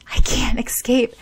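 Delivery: panicked whisper with a sob; frightened whisper. Acted. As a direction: whisper it on the edge of tears. Trapped under ice.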